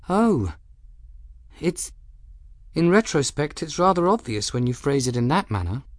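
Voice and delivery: put-on light young woman's voice, realising her mistake; young woman Bridget, sheepish. A narrator's reading. Bridget voiced, slightly embarrassed.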